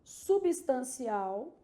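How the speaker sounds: Substancial very slowly, syllables stretched; marking the syllables. Shown as a demonstration. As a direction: draw out subs-tan-ci-AL. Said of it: "marcando as sílabas tônicas aqui".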